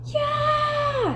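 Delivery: very high shrill-pitched yell; a girl screaming out in the classroom. A narrator's reading. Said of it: "screamed Leslie".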